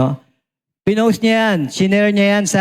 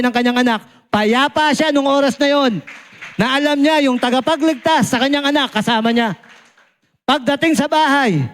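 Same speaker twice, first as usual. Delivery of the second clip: preaching; 7 semitones higher than usual. Real, not acted. Preaching at full force.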